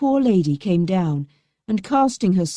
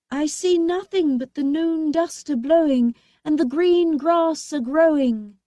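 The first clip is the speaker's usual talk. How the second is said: high sing-song answer; sister Anne, lightly voiced; 9 semitones higher than usual. A narrator's reading. Anne answering from the tower. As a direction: report from the tower, lilting.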